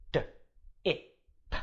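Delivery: D, I, P said sound by sound; sounded out again. Shown as a demonstration. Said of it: "Let's do it one more time".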